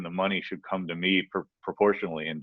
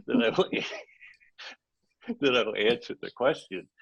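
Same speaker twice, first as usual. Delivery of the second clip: helpless laughter breaking into the words; laughing; 8 semitones higher than usual. Real, not acted. Host cracking up at own office-hours bit, laughing through words. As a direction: try to get it out through giggles.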